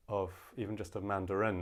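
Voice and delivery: ordinary talk, nothing marked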